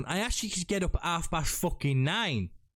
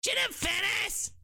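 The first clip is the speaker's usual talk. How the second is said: very high, odd-timbred cartoon cry; silly character shout. Host clowning for co-host, high character voice. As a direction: call out the name in a high cartoon voice. Silly voice bit after South Park remark.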